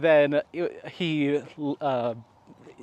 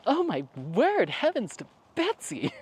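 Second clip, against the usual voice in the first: higher, fussy "oh my word, heavens to Betsy"; flustered exclamation; 9 semitones higher than usual. Retold or imitated. Vlogger burning something in the oven, high flustered fussing. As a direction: fret in a high prim voice.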